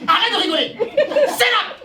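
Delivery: high, loud scolding yell; mock angry shout. Drama teacher scolding a laughing spectator, loud. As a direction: snap at the giggling class.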